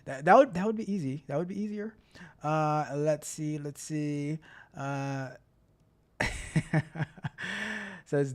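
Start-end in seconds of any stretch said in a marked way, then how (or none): 6.2-8.0 real burst of amused laughter. Real, not acted. Streamer reading the chat, bursting into laughter. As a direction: laugh at what you just read.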